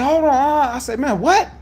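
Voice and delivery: high, loud retold challenge; getting loud. Him confronting the dispatcher, loud and high. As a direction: raise his voice at her. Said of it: "I get loud".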